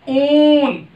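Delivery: ऊन with the ऊ pulled long; stretched long oo. Shown as a demonstration. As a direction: draw out the ऊ in ऊन. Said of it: "ऊपर खींचकर बोलेंगे तो वो दीर्घ हो जाएगा". (ऊ को खींचकर दीर्घ बोलें)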